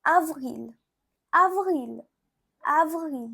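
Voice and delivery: avril as French "ah-vreel"; French pronunciation. Shown as a demonstration. Teacher showing learners April in French, careful French avril. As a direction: repeat avril carefully, spaced out. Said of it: "You can say".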